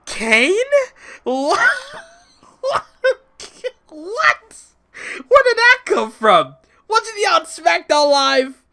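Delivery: high, loud incredulous outburst with a laugh; cracking, squeaky shock at a surprise return; stunned disbelief. Retold or imitated. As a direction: replay the moment you couldn't believe it. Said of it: "I'm just like".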